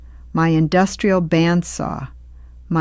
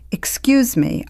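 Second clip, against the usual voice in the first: higher, sharp and controlled; cutting, not loud; 7 semitones higher than usual. A narrator's reading. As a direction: cut through the room without raising your voice. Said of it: "My voice was not loud, but it cut".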